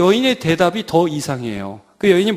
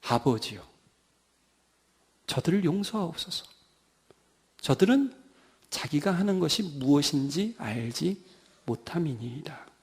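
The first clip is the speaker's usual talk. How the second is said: soft, low plea with long pauses; subdued, halting intercession; hushed dying prayer; 4 semitones lower, 9 dB quieter than usual. Acted. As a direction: pray quietly, as if spent. Voices Jesus praying on the cross.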